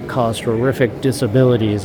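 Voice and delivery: usual speaking voice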